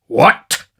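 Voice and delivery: explosive, loud burst; outraged interruption. A narrator's reading. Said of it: "bursting into the room".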